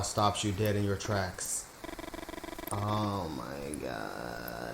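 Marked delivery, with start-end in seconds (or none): 2.7-4.8 low, very slow dread; groaning in disbelief at the bear; drawn-out low dismay. Real, not acted. Bear appears again in game.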